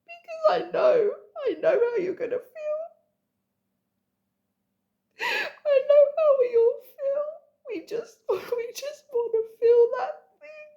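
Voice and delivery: high, breaking voice, sobbing through the words; crying. Real, not acted. Crying to her viewers about the feeling they share. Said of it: "it's making me feel emotional".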